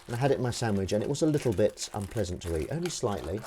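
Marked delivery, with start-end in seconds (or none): none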